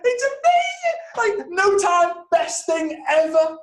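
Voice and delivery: high, loud, drawn-out fake rapture; mock delight. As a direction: gush absurdly, stretching every word. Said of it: "No one's ever gonna go".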